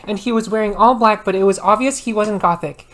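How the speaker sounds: usual speaking voice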